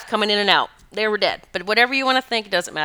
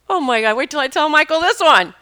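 high, loud and thrilled; excited exclamation; 7 semitones higher, 6 dB louder than usual. Retold or imitated. Woman eager to tell Michael her story, excited. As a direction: burst with news to share.

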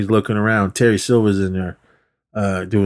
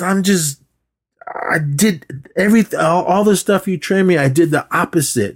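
frustrated whining; 7 semitones higher than usual. Retold or imitated. Frustrated student retold.

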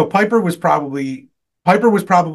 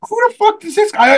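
very high, louder mock outrage; retold indignant outburst; 5 dB louder than usual. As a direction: re-enact your outraged reaction way up high. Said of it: "I said it like that too".

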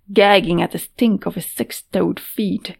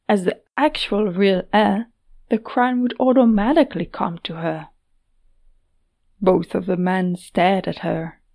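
plain reading voice

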